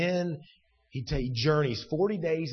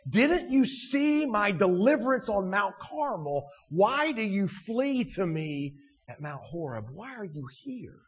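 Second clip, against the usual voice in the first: God challenging Elijah; 5 semitones higher than usual. Acted. Preacher voicing God to Elijah, challenging, hushed at the end. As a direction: plead it high, then hush the last question. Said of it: "God is saying to Elijah".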